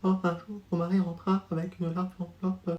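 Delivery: barely audible reading. Acted. Pupil reading to the teacher so softly nobody hears, very quiet. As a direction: mumble the text so the class can't hear. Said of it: "parce qu'on t'entend pas".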